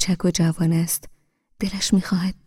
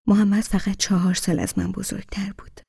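usual speaking voice

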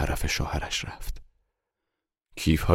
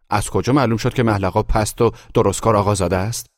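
loud, raised-pitch challenge; arguing retort; 4 semitones higher, 7 dB louder than usual. A narrator's reading. Guest disputing who is lowborn, loud combative retort. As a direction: fire back at the other guest.

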